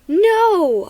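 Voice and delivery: loud high yell of alarm. A narrator's reading. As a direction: cry out "no" at full voice. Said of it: "I yelled".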